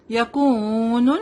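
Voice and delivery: یکون with the واو stretched very slowly; long vowel. Shown as a demonstration. Teacher shows the madd on the waw, very slow. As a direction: draw out the oo in یکون. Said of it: "واو مد ہے یہاں پہ".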